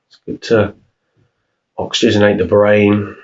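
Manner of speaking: unhurried meditation voice, long pauses between phrases; guided meditation. Real, not acted. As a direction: calmly guide them through each breath, leaving room to breathe. Leads a breathing meditation.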